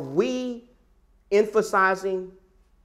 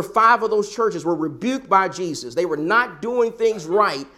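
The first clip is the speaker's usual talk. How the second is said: ordinary talk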